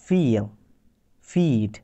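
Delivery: ফিল and ফিড with a long ee. Shown as a demonstration. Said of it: "এই ওয়ার্ডগুলোতে আমরা long ই উচ্চারণ করছি".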